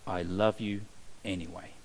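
low, soft I love you anyway; tender. Shown as a demonstration. God assuring sinners, low and tender. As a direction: drop low and gentle on love. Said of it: "that's what the love of God is like".

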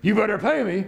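high, with a sneering laugh; bullying menace. Retold or imitated. Servant bullying his debtor, sneering high. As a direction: gloat over your grip on him. Servant's real intent voiced.

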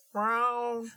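high meowing brown, brown; high cat voice saying brown. Pretend. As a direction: meow the word brown like a cat. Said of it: "What'd you say?".

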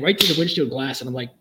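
ordinary talk

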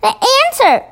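high, eager exclamation; excited cry. A narrator's reading. Sophie tells the BFG her plan, excited cry. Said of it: "cried Sophie".

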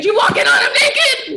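high, loud shriek of disbelief; shocked shout. Real, not acted. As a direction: blurt it out, scandalized and laughing. Co-host's shocked interruption of the story.

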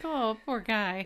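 slow, drawn-out sympathy; mock pity. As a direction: sigh over the hurt dragon. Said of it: "I'm like".

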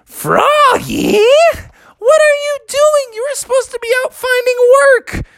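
very high astonished scolding; shocked parent. Acted. Parents recognise their clown son, shocked. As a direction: squawk high in disbelief.

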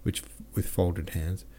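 usual speaking voice

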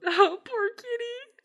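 high, slow cooing pity; mock pity. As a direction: croon sympathy for the kitty. Pity for the hit jaguar.

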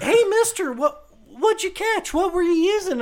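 very high-pitched mock kid voice; thirteen-year-old kid. Kid walks up to ask about the catch, high and eager. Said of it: "thirteen-year-old kid comes up".

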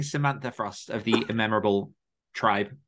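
usual speaking voice

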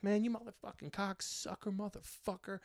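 high, sloppy mock insult; mock drunk heckler. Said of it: "he said something like".

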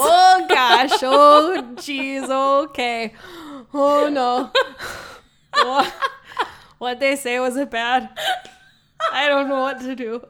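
hammed-up Minnesota accent through laughter; terrible Minnesota accent. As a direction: play a fretful Minnesotan, badly exaggerated. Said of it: "our terrible Minnesota accents".

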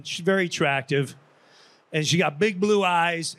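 ordinary talk

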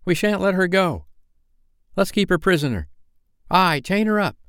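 put-on high-pitched boys egging each other on; mildly menacing childish outcry; threatening cry. A narrator's reading. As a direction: threaten her as a panicky young gang. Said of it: "advanced upon her threateningly".

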